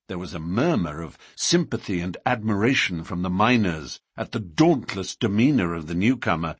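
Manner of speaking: ordinary delivery, nothing marked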